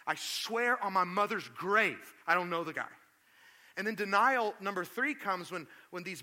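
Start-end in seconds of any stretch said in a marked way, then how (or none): 0.0-2.9 solemn oath. Retold or imitated. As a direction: swear it on his mother's grave. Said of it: "Peter used an oath".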